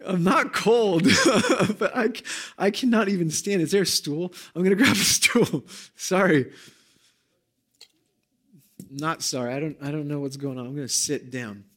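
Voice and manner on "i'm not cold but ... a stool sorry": shaky nervous laughing talk; jittery, laughing through nerves; stage fright. Real, not acted. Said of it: "I am shaking right now".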